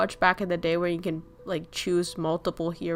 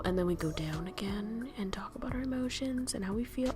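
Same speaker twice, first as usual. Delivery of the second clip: hushed whisper, softer; 8 dB quieter than usual. Pretend. Streamer acting out dolphins diving down into feelings, whispering. Dolphins going deep, emotions.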